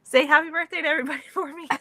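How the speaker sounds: laughing quote. Retold or imitated. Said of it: "And every year I'm just like".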